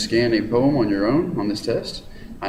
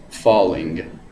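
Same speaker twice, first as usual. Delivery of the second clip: "falling" drawn out slowly, dropping; slow falling demo; 3 semitones lower than usual. Shown as a demonstration. Teacher shows trochaic fall, slow and dropping. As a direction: let the word sink slowly. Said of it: "starts up here and goes down here".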